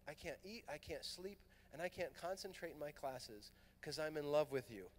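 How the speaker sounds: a soft, shy voicing of a woman confessing love; hushed confession. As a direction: confide it nearly under the breath.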